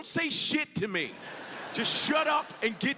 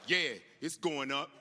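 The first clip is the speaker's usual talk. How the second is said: Eddie Murphy voice; 4 semitones lower than usual. Pretend. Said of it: "that eighties Eddie Murphy voice".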